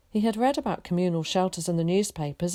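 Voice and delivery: ordinary delivery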